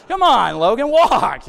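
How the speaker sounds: loud, high exasperated call; exasperated urging. Retold or imitated. Dad prodding his stuck toddler to walk, loud and exasperated. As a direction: call out loudly, pleading with the kid to move.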